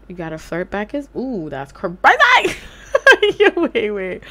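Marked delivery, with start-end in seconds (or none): 2.0-3.3 loud laugh burst. Real, not acted. Laughing at game.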